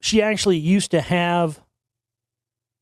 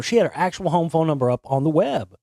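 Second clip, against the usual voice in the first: normal talking voice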